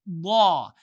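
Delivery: "Law." with the tongue at the top teeth; Arabic lam sound. Shown as a demonstration. Teacher demonstrating the letter, "Law." said loud.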